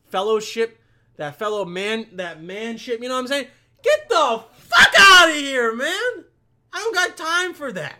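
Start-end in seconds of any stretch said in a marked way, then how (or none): 3.8-8.0 loud, high furious yelling; angry shout. Acted. Gym-goer yelling a stranger away, furious shout. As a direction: blow up and shout him away. Ranting at imagined gym guy.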